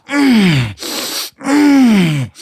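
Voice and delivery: loud, high hammed-up "mmm"; savouring moan. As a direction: moan with exaggerated relish. Revived buddy savouring dip.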